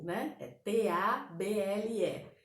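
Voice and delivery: ordinary talk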